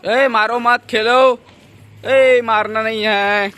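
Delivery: loud, high scolding call across the yard. Real, not acted. Telling kids not to hit.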